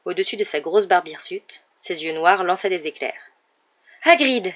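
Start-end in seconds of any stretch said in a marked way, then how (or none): 4.0-4.5 loud, high cry of relief; relieved exclamation. A narrator's reading. Harry spots Hagrid in the alley, relieved shout. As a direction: call out as if rescue has come. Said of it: "s'exclama Harry avec soulagement".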